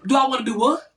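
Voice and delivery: loud, incredulous comeback; indignant retort. Retold or imitated. Wife retelling her answer to husband's chore request, indignant retort. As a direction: throw it back in loud disbelief.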